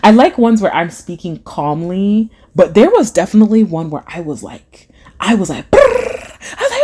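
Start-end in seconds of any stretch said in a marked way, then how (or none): 5.7-6.8 mock frantic trilled brrr; hammed-up motor-mouth trill; imitated hype noise. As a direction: roll out a hyped-up brrr. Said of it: "sometimes I come on here hype".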